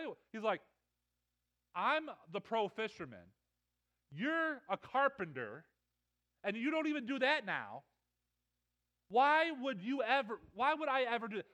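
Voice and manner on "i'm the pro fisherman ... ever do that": acted-out incredulous pushback; indignant protest. Tired fisherman objects to the carpenter's request, indignant protest. Peter's indignant thoughts at Jesus.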